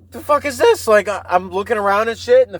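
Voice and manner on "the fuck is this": high alarmed question; a jumpy, baffled outburst; startled. Retold or imitated. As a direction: blurt it out, spooked and confused. Startled by scary radio commercial.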